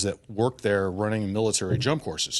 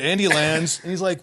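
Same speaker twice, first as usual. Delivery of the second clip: normal talking voice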